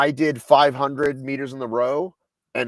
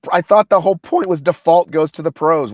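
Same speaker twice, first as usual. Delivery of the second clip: louder, higher protest of disbelief; real disbelief, voice raised; indignant disbelief; 5 semitones higher, 9 dB louder than usual. Real, not acted. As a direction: push back, can't believe the rule changed. Co-host objects to new qualifying rule.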